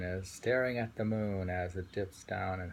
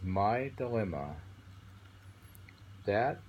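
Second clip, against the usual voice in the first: poem reading. Acted. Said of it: "He says".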